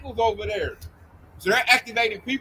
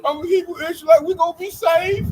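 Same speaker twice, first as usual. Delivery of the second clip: mock little girl voice; 7 semitones higher than usual. Mocking scared callers as little girls, high whiny. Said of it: "they sound like a bunch of little girls".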